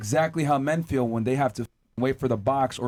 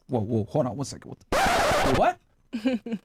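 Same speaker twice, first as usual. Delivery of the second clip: a hammed-up double take, squeaking up on what; mock disbelief; 4 semitones higher, slower than usual. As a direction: act the shocked buyer, pitch jumping. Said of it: "And she's like".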